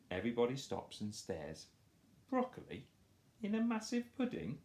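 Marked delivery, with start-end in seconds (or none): 2.3-4.6 much higher, disbelieving; shocked. Acted. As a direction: gasp at the idea. Everybody stares in shock.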